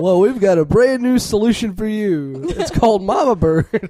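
high, bright ad pitch, laughing; infomercial. Pretend. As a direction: pitch the product like an ad host. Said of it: "This sounds like an infomercial".

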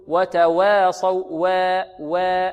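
"وتواصوا" with waa tilted to weh; over-thin the waw in "وتواصوا" toward an e vowel; over-thinned imala. Shown as a demonstration. Teacher mimics some students' error, tilted vowel in "وتواصوا". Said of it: "هذا تقليل أو إمالة كبرى".

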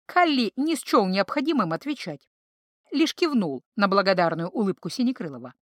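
ordinary delivery

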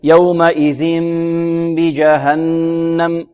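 touch lips without pressing and hold the nasal sounds in "يومئذ بجهنم"; lips lightly closed ghunna. Shown as a demonstration. Teacher showing lips together without pressing, lightly closed ghunna. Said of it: "ببعضهما بدون كز".

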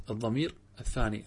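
usual speaking voice

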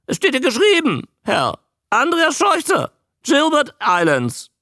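very high, loud squawky creature; loud, high, quick croak; croaking newt. A narrator's reading. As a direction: introduce yourself as an eager talking newt. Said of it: "krächzte er".

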